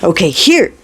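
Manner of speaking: quick burst of urgency; urgent cry. A narrator's reading. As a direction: call it out in a hurry. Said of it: "Talk cries".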